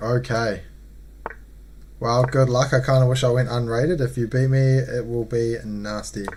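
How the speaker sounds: ordinary delivery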